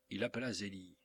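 usual speaking voice

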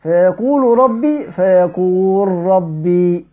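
slow recitation of فيقول ربي as fayaqur-rabbi; merged l into r. Shown as a demonstration. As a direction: merge the lam of فيقول into a doubled ra. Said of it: "زي إيه؟".

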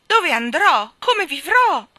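king shouting in despair; desperate cries. Acted. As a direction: wail in panic. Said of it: "gridò il re".

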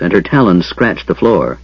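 ordinary delivery, nothing marked

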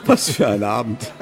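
chuckling through the memory; laughing. Acted. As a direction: laugh it off, embarrassed. Harry remembers awkward ball.

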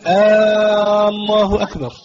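first vowel of الله drawn out very long, like a question "aaallahu akbar"; questioning mispronunciation. Shown as a demonstration. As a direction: stretch the opening A of الله on a high, held note. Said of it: "يستفهم وكأنه مستنكر".

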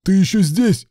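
loud, high angry bark. A narrator's reading. Boss snaps at his lingering assistant, barking. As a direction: bark it at an unwelcome subordinate. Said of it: "рявкнул Руслан".

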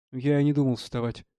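ordinary delivery, nothing marked

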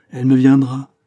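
loud, stubborn insistence; grumpy child. A narrator's reading. Woman insists back at the priest, grumpy and loud. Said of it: "à la façon des enfants grognons".

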